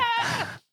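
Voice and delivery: high, shocked scream; a teen hearing the cops are at home, screaming in dread. Retold or imitated. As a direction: shriek in horror at the news. Mom says you're going to jail.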